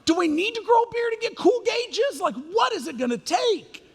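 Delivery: exasperated parent. Retold or imitated. Parent whose kid quotes another pastor, exasperated and high. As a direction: yelp it out in disbelief.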